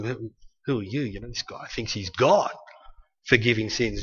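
low, soft muttering of doubters; grumbling whisper. Retold or imitated. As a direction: grumble it under your breath. Grumbling doubters voiced in sermon.

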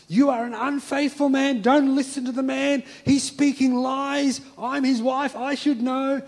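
high, loud, accusing mock yell from a wife; angry wife yelling. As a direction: play the wife shouting him down in the meeting, half-acted. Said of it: "be screaming at him".

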